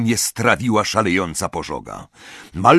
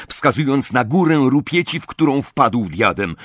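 ordinary delivery

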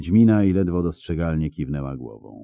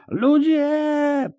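very high, drawn-out cry; high-pitched call for people; screamed alarm. A narrator's reading. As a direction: scream to rouse the whole street. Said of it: "wrzasnął ktoś".

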